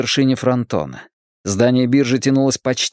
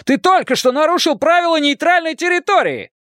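outraged, very high-pitched accusation; furious high-voiced protest; angry yell. A narrator's reading. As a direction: yell it at the offender. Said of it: "заорал он на Хейлса".

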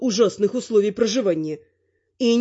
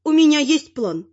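high-pitched granny address; raised old-lady character voice; pronouncing granny; 7 semitones higher than usual. A narrator's reading. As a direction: announce it as a plotting grandmother. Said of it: "вещала бабуля".

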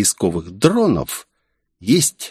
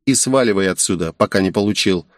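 usual speaking voice

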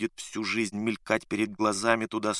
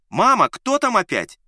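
calling from another room; 11 semitones higher, 9 dB louder than usual. A narrator's reading. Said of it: "послышался голос Лукашина".

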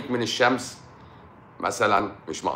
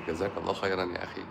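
quick dismissive thanks; 2 semitones lower, 8 dB quieter, faster than usual. Shown as a demonstration. Doctor mock-thanking a commenter to dismiss him, quick and soft. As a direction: rattle off the thanks fast and softer. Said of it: "يعني أمسك أقول لك".

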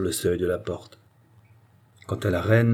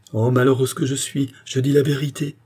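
raised, wretched outcry; anguished exclamation; 6 semitones higher, 4 dB louder than usual. A narrator's reading. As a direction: lament it with pitch lifted. Queen laments in acted quarrel.

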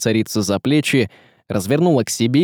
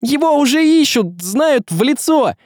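high, insistent cry; urgent interruption; 4 dB louder than usual. A narrator's reading. Young man interrupting the sorcerer, urgent. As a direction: cut in at high pitch. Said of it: "перебил его Василий".

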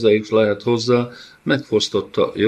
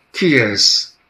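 higher, louder angry creature hiss; 4 semitones higher, 6 dB louder than usual. A narrator's reading. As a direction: spit it out furiously. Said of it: "sziszegte dühötten Dana".

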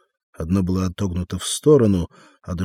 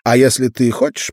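lightly thin tenor, a bit louder; 4 semitones higher, 7 dB louder than usual. A narrator's reading. Sam rambling to Arnold about his deals, light thin tenor. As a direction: chatter on in a slight tenor. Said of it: "тонким тенорком говорил Арнольду Сэм".

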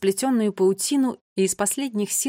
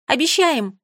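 higher, louder parting call; raised, keen exclamation; eager promise; 5 semitones higher, 6 dB louder, slower than usual. A narrator's reading. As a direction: chime in brightly with a promise. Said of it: "добавила Нола".

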